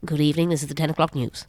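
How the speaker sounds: put-on quick, clipped-sounding old BBC read; RP newsreader. Recalling the old BBC, a quick Received Pronunciation news read. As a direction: read it like a 1950s BBC announcer. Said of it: "it used to be R P on the B B C".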